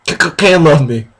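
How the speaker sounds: stuttering, half-laughing fake cry; mock sobbing stammer. Reading the heroine's tearful confession, hammed-up. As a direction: stammer through fake tears. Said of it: "she started crying".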